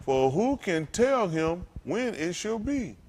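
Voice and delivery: slow, deliberate; slow verse reading. Shown as a demonstration. Preacher reading the verse line to the church, slow. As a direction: lay out each word slowly. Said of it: "reading verse slowly".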